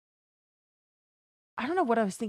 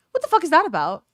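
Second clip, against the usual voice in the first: higher, louder on fuck; What the fuck is that about with fuck stressed hard; emphatic outrage; 6 semitones higher, 5 dB louder, faster than usual. Real, not acted. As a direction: punch the word fuck, indignant. Outraged repeat of the question.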